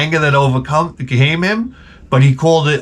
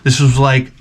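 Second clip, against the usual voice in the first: ordinary talk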